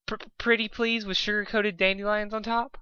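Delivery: pleading pony voice. Acted. Begging friend.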